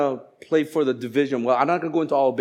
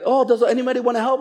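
chirpy group leader; 9 semitones higher, faster than usual. Retold or imitated. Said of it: "Your life group leader is like".

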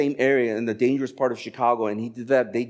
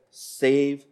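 slow, deliberate instruction; wife's instruction; much slower than usual. Retold or imitated. Wife instructing husband, slow and deliberate. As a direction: spell it out slowly, imitating wife. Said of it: "I remember she said".

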